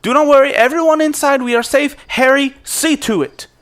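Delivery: high, loud commanding reassurance; Caspian calls out. Acted. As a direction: call over the crowd to calm them, then order. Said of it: "you hear Caspian's voice".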